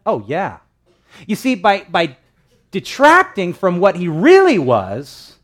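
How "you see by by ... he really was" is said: preaching emphasis. Real, not acted.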